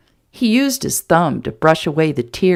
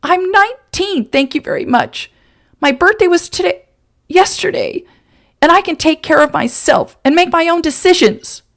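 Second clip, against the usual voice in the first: much higher, louder indignant retort; 11 semitones higher, 5 dB louder than usual. Acted. Woman correcting the man about her age. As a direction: fire back, offended.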